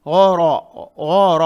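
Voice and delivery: गौर with both syllables thick and dark; heavy full-mouthed letters. Shown as a demonstration. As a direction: say गौर as a rounded gho-ro. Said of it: "गौ भी मोटा, रॉ भी मोटा".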